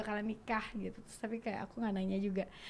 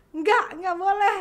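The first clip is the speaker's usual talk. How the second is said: high, loud retold no; raised, emphatic mock refusal; firm refusal; 9 semitones higher, 11 dB louder than usual. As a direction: act out a manager putting their foot down. Acts out manager's firm refusal.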